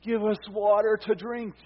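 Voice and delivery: a thirsty crowd's loud complaint; quarreling demand. Retold or imitated. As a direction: throw the demand at Moses. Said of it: "they quarreled with Moses and said".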